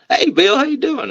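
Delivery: high, friendly phone greeting; mock upbeat phone hello; imitating the other wrestler. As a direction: answer the call all cheery. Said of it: "He picks up".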